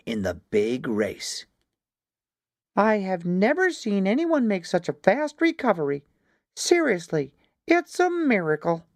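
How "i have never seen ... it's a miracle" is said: higher, amazed nurse voice; Nurse Betty. A narrator's reading. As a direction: marvel at the fast recovery like a nurse. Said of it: "said Nurse Betty".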